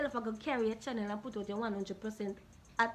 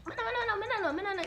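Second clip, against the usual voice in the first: mocking girl; 8 semitones higher, much faster than usual. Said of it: "the girl got text back up and say".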